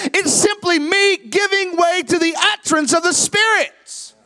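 high, loud, ringing preacher's call, slowing at the end; sermon peak, lifted high and loud; full-force preaching. Acted. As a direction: preach it out to the whole room. Preaching climax on supernatural experience.

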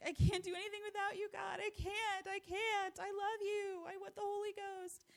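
put-on high, tearful, pleading child's voice; a small boy sobbing out his prayer; crying child's prayer. Mother imitates her crying son praying on the couch, high and tearful. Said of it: "And so he's just crying".

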